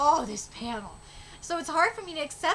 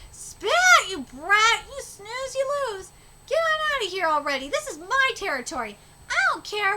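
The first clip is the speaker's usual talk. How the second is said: put-on old granny yelling; 6 semitones higher, 6 dB louder than usual.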